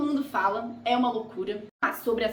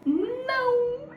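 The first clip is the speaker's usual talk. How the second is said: emphatic no; 13 semitones higher than usual. Acted. Vlogger answering her own question about Malta, high emphatic no. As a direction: snap the answer out high and sharp.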